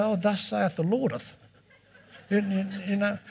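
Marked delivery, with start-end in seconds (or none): 0.0-1.3 a lightly hammed-up churchy preacher voice; mock the pompous old preacher. Pastor mocking how he did not answer, lightly hammed-up churchy voice. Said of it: "I didn't go".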